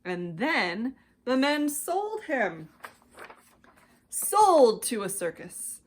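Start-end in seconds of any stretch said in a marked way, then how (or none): none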